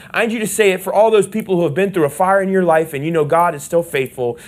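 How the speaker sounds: ordinary delivery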